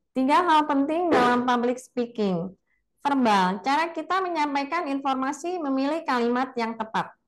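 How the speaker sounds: high-pitched recited student delivery; student's weak voice. Retold or imitated. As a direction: imitate a nervous student just reading off the slide. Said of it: "mahasiswa-mahasiswi saya yang suaranya seperti itu".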